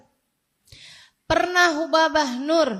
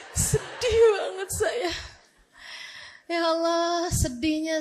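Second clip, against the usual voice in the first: laughing sadly; 4 semitones higher, slower than usual. Real, not acted. Laughing about her sadness.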